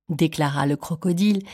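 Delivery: ordinary delivery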